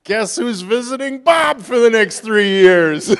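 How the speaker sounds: a playful wink at the congregation; amused punchline. Real, not acted. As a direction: land the joke with a grin in the voice.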